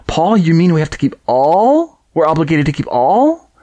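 high, sarcastic mock disbelief, rising. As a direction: gasp and feign outrage, sarcastically. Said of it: "the sarcasm in my voice".

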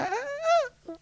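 very high, soft sung laugh; musical laugh imitation. Host showing how the guest laughs, musical high laugh. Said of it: "musical, like, triad".

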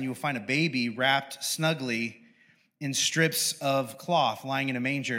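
measured Bible reading, slowing at the end; scripture reading. Acted. Pastor reading the angel's announcement, measured. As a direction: read the angel's words as scripture.

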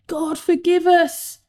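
high-pitched plea; crying out. A narrator's reading. Sailors as they toss Jonah overboard, crying out. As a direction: call it high, begging forgiveness. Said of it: "the sailors cried".

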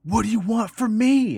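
imitating a panicked man yelling into the phone; frantic plea. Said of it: "And he's like".

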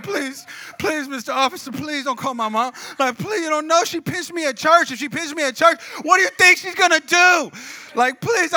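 hammed-up wailing appeal; mock crying plea. Teen begging officer not to call mom, mock crying. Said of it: "Like, I was crying".

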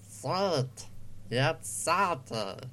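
slow, half-hearted cartoon duck; failed Daffy Duck. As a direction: lightly attempt a Daffy Duck and miss. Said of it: "that's not a Daffy Duck voice".

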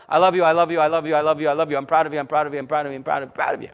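rattled off on a loop, run together; rapid-fire reassurance. Shown as a demonstration. Models parents' constant reassurance to kids.

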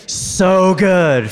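big loud, high group shout; yelled from the gut. Real, not acted. Preacher leading the congregation's yelled response, loud. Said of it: "Yell it out".

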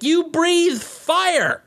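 high, drawn-out mock incredulity; slow, hammed-up astonishment at sheer stupidity; exasperated disbelief. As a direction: stretch each word out in high-pitched disbelief. Mock disbelief at helpless dragons.

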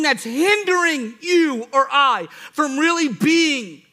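high, loud, emphatic preacher; full-force preaching. Real, not acted. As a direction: drive the point home at full volume, stretching the last phrases.

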